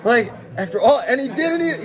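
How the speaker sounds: host exasperated at a guest's unstripped linen, disbelieving; incredulous. Retold or imitated. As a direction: throw up your hands in mock outrage. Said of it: "And you're like".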